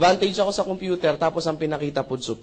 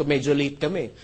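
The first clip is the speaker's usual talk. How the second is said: normal talking voice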